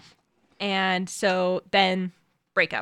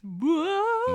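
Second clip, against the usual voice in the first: mock surprise; 13 semitones higher than usual. Reacting to dramatic twist.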